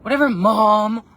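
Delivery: put-on loud, slow teenage brush-off; drawn-out, loud adolescent retort; nineties teen self. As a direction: play your don't-care teen self sassing mom. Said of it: "nineteen ninety-eight [name]".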